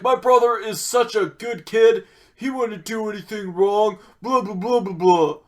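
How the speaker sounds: high, loud parody of a doting sibling; mocking imitation. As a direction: mimic him mockingly, singsong and high. Mocking the brother's defence of kid.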